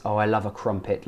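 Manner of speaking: ordinary talk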